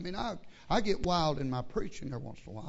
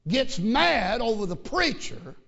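loud preaching; 2 semitones higher, 7 dB louder, slower than usual. Real, not acted. Preacher scolding in sermon.

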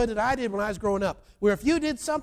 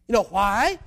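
normal talking voice

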